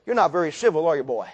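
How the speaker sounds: low, soft needling; condescending menace. Retold or imitated. Herod to a silent Jesus, condescending menace. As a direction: drop down and chide the prisoner.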